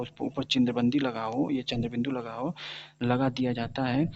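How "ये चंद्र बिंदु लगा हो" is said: ordinary talk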